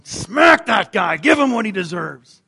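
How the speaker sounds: slow, loud jeering shout; crowd shout. Retold or imitated. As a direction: yell it like an angry onlooker. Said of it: "people that were going".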